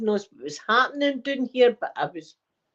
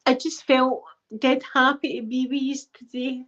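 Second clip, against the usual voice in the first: higher voice, overcome with feeling; emotional, near tears; 5 semitones higher than usual. Real, not acted. Telling viewers about being with them today, tearfully emotional. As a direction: push the words out through welling tears. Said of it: "I did get dead emotional today".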